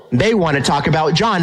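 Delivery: normal talking voice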